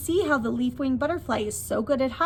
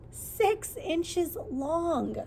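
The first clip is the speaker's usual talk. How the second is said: drawn-out, higher reveal; slow, higher answer for little listeners; 6 semitones higher, 3 dB quieter, much slower than usual. Acted. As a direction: stretch each word to make the answer big.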